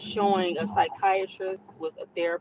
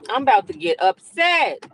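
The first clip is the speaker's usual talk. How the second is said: angry outburst; 3 semitones higher, 10 dB louder than usual. Real, not acted. Angry at other driver.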